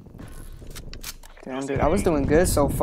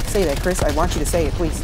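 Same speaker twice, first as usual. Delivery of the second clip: ordinary talk, nothing marked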